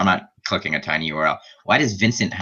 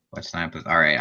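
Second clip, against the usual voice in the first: ordinary delivery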